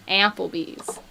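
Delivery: Applebee's with a Buffalo short-a on the first syllable; Buffalo vowel. Shown as a demonstration. Streamer demonstrating hometown speech, Applebee's with Buffalo vowel. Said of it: "It's kind of that sound".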